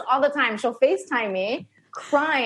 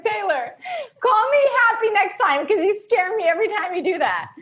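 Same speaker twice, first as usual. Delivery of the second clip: mock panic; 10 semitones higher than usual. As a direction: beg her not to scare you. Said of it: "And I'm like".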